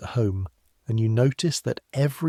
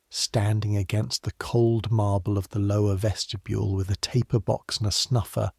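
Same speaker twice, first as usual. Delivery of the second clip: ordinary delivery, nothing marked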